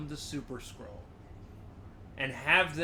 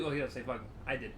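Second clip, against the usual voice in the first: ordinary talk, nothing marked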